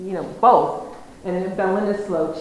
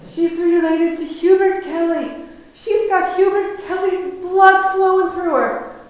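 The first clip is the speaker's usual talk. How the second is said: high, loud imitation of a proud old farmer; proud proclaiming; 9 semitones higher, 7 dB louder than usual. Old farmer telling the shop whose blood she carries, high and loud. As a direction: announce her bloodline to the room like big news.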